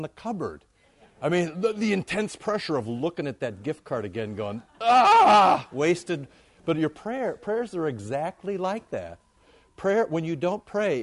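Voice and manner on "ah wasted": a loud, high groan of frustration; exasperated groan. Retold or imitated. Preacher mocks himself staring at the unused gift card, loud exasperated groan. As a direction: let out a big pained groan over the waste.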